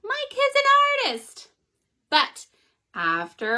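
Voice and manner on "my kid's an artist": very high amazed cry; over-the-top proud mother; amazed mom. Acted. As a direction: squeal with pride at the drawing. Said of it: "Her mother said".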